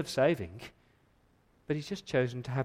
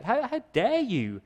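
indignant rebuke; 9 semitones higher, slower than usual. Acted. Rebuking the objector for God.

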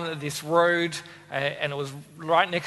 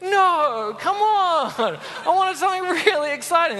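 high, laughing mock complaint; laughing protest; 10 semitones higher than usual. Real, not acted. Preacher recalling his disappointment, high and laughing. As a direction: laugh while protesting the letdown. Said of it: "so disappointing for me".

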